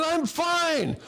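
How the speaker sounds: high, shouted brush-off; insistent shout. Retold or imitated. Brushing off wife's worry.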